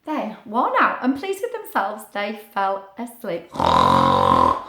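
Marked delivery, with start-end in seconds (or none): 3.5-4.6 very low, loud snoring sound; snore. Pretend. Acting the sleeping crooks, a very low loud snore. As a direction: rumble it like a noisy sleeper.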